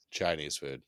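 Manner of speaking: low, dropped-voice punchline; deadpan punchline. Pretend. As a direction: drop the voice flat for the answer.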